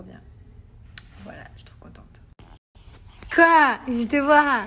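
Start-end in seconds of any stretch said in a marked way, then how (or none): 3.3-4.7 raised playful mum voice; playful exclaiming. Real, not acted. Playful calling to her baby.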